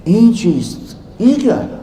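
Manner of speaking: high, amazed gasp of a question; astonished question. Retold or imitated. Rumi asking Shams in disbelief about the dry books, amazed. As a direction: ask in sheer disbelief.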